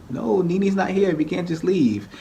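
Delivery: high, slow pleading protest; pleading ladies. Retold or imitated. Other ladies pleading against leaving without Nene, high and slow. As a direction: beg them to wait for her.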